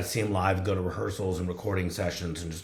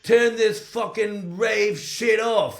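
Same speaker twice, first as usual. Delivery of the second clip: much higher, louder, slow, furious British voice; angry British rocker; 12 semitones higher, 6 dB louder, slower than usual. Retold or imitated. Rocker ordering bartender to kill the rave music, furious. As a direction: spit each word out like a fed-up British rocker. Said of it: "And then he goes".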